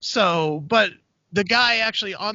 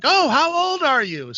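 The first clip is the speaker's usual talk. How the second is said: higher, drawn-out teasing question; crowd work comic; 8 semitones higher, 4 dB louder than usual. Retold or imitated. Comedian going after the birthday answer, higher mock interest. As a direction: imitate a comic pouncing on an answer. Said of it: "And the guy's like".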